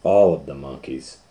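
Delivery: ordinary delivery, nothing marked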